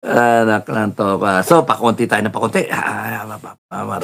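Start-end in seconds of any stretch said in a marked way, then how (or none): none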